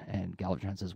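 usual speaking voice

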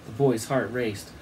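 usual speaking voice

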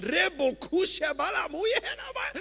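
high, rapid ecstatic tongues; speaking in tongues. Real, not acted. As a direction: burst into tongues mid-sermon. Preacher breaks into tongues.

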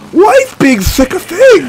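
high, strained complaint; squeaky, sick-voiced outburst; squeaky exasperation. Real, not acted. Exasperated rant about sick voice.

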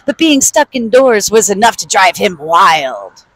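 usual speaking voice

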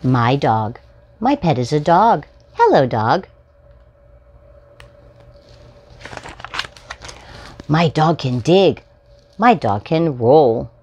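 bright, sing-song picture-book reading; reading to children. Acted. Host reading a picture book to young viewers, storytime voice. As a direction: read it out like storytime for little ones.

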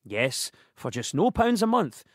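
normal talking voice

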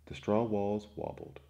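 plain reading voice

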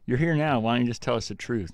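ordinary delivery

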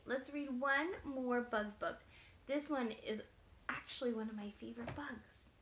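usual speaking voice

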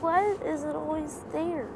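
ordinary delivery

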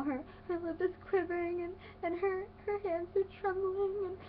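high, whimpering mock sob; hammed-up tearful wail, building; fake cry. Imitating little cousin about to burst into tears, fake cry. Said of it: "You know, the fake cry".

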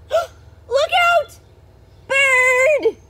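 very high, loud alarm cry; panicked cry. Acted. Snails warning each other of the bird, panicked. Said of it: "cried the snails in panic".

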